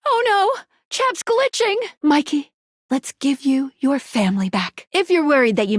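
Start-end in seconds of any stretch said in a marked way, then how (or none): 0.1-1.8 high-pitched mock alarm; high, theatrical dismay. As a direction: cry out that something is going wrong. Acted alarm at a glitch.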